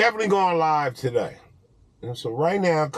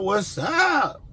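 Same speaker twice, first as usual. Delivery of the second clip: shouted greeting; 7 semitones higher than usual. Shown as a demonstration. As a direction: call out "What's up?" like hyping up the viewers.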